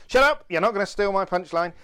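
high, loud playful shout. Real, not acted. [name] yelling at Cole to keep his punchline, playful shout. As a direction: yell to stop him stealing the punchline.

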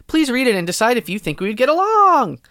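usual speaking voice

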